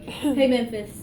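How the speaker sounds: ordinary delivery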